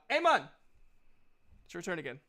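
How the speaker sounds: usual speaking voice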